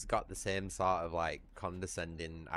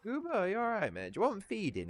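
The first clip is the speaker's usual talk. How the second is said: much higher cooing voice for the cat; sing-song pet talk; 13 semitones higher than usual. Real, not acted. Talking to his pet.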